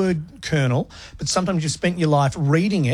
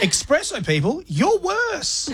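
mock scolding; 11 semitones higher, slower than usual. Mock scolding, pitch soaring.

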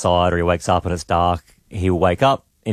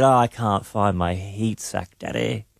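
put-on slow, small toddler complaint, trailing softer; lightly voiced forlorn child; imitated child complaint; 4 dB quieter, slower than usual. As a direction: mimic a little kid slowly pleading to Dad. Repeats retold toddler's complaint.

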